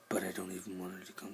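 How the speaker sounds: normal talking voice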